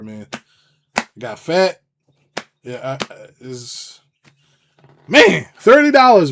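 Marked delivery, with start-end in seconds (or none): 5.1-6.3 incredulous outcry. Real, not acted. Incredulous outburst.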